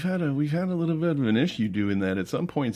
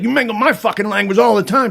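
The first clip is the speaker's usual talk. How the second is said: high, loud squawking retort; shrill argument; 11 semitones higher, 7 dB louder than usual. Retold or imitated. Teacher shouting back in the knife fight, shrill. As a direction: shriek it back at him. Heated quarrel.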